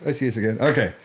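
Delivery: usual speaking voice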